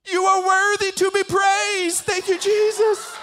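loud, high-pitched cry of worship; hammed-up fervent, tearful shout; imitated tearful praise. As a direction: mimic an overcome child praising at full volume. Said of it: "these are his exact words".

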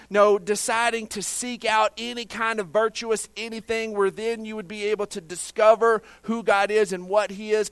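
high, loud, driving sermon voice; full-force pulpit delivery, hammering key words; forceful preaching. Acted. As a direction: preach with mounting urgency to the congregation.

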